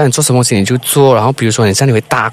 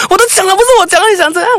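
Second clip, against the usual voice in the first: much higher, loud protest; loud demand; much faster than usual. Shown as a demonstration. Host showing the caller how to shout at her sister. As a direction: yell it back in protest. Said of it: "然后你再大声".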